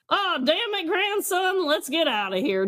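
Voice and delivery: high, lightly voiced grumbling old lady; Susan on the scooter. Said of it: "Susan on the scooter that's like".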